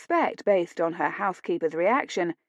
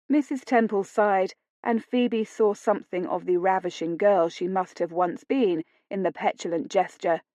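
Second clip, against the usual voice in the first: usual speaking voice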